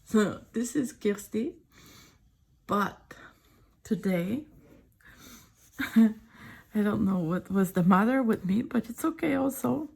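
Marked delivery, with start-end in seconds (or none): none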